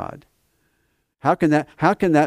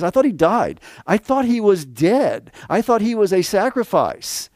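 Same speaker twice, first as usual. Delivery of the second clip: high, louder mock surprise; mock puzzled objector; 9 semitones higher, 3 dB louder than usual. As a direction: play the doubting listener, half-hammed. Mock objection, then answers himself.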